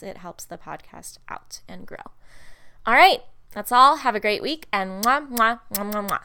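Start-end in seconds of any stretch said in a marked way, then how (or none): 4.7-6.2 blowing kisses. Real, not acted. Signing off with kisses.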